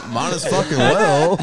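slow, loud words broken by laughter; laughing through it. Real, not acted. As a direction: say it while cracking up. Laughing through retold reply.

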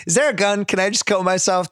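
mock despair. Father hearing his son ate victims, retold as mock despair. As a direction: plead it as comic overwhelm.

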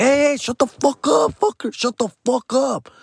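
high, sharp put-on voice; friend snapping. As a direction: cut him off hard and high. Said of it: "my friend's like".

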